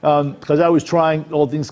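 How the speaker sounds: ordinary talk, nothing marked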